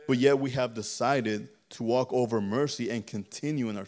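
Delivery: loud, emphatic preaching. Real, not acted. Preacher confronting the congregation over its pride, preaching forcefully. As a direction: drive each charge home with force.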